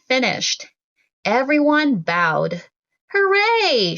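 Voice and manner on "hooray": cheer. Acted. Mom cheering after the shadow play, high cheer. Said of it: "shouted Mom".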